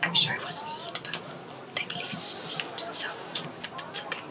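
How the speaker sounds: hushed whispered aside. Real, not acted. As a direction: whisper it to the table.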